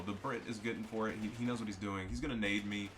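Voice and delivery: ordinary talk, nothing marked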